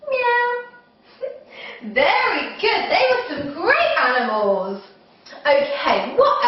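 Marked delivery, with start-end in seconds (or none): 0.1-0.6 very high imitated meow; cat meow. Shown as a demonstration. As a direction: squeak out a kitty meow. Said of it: "And cat".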